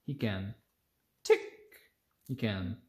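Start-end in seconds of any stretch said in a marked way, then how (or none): none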